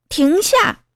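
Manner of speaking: high-pitched call to stop; shouted order. Acted. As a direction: call out a sharp command. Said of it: "小胡大喊".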